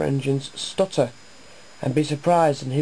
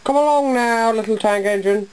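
grand, pompous call, pitched high; lording it over the little engine; impressive; 7 semitones higher than usual. A narrator's reading. Finch calling down grandly to little Joe, pompous. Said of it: "he said impressively".

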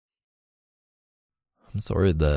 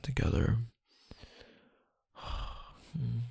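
ordinary delivery

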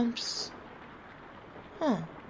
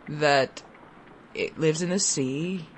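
awkward father; 6 semitones lower, 3 dB quieter than usual. Acted. Father answers child awkwardly.

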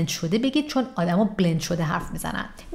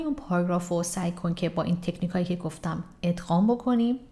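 ordinary delivery

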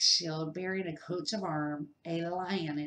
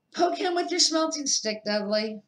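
higher, louder bossy prod; loud gruff urging; 8 semitones higher, 6 dB louder than usual. A narrator's reading. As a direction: egg the boy on loudly. Vernon urges Dudley.

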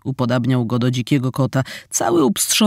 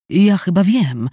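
ordinary delivery, nothing marked

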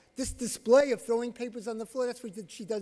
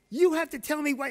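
sharp, raised and higher, firm command; stern; 7 semitones higher, 6 dB louder than usual. Retold or imitated. Therapist starting to order a six-year-old, stern and raised. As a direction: cut in with authority. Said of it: "in a very stern voice".

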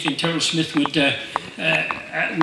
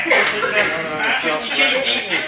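ordinary delivery

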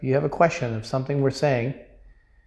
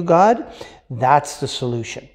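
normal talking voice

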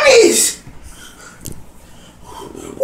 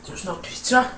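quiet disbelief; 13 semitones lower, 14 dB quieter, faster than usual. Real, not acted. Quiet disbelieving question about pizza.

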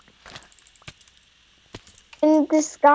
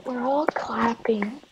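sarcastic whisper; 2 semitones lower, 10 dB quieter, slower than usual. Pretend. Sarcastic fake applause.

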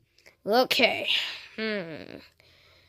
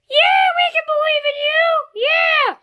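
very high, squealing cartoon voice; shrill puppet cheerleading; ear-splitting cheer; 12 semitones higher than usual. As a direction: squeal fast encouragement, piercingly high. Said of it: "Your voice is hurting my ears".

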